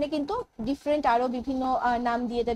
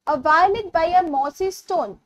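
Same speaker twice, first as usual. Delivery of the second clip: very slow and a little louder; slow poem recitation; 5 dB louder, much slower than usual. Shown as a demonstration. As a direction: read the line out again as verse.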